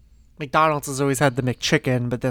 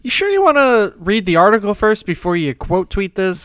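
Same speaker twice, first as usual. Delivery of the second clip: raised, louder voice for the app's warning; mock app prompt; 6 semitones higher, 6 dB louder than usual. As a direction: put on a pestering pop-up question. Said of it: "Twitter feature that's like".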